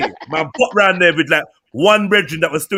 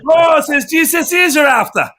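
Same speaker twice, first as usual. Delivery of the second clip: higher-pitched mock Irish lilt; Irish accent; 6 semitones higher, 4 dB louder than usual. Offering to sell GCSEs as a joke, in an Irish accent. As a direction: put on an Irish salesman's patter. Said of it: "We get this accent all the time".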